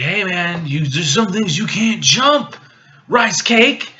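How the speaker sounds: high, slow taunting delivery; mock taunt. Mace taunting Anakin about the jump, hammed-up taunt. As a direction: stretch it out and punch the nickname.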